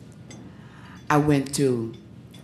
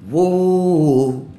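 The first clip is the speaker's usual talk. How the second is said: singer's whoa; 4 semitones higher, 6 dB louder than usual. Retold or imitated. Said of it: "He went to the next person".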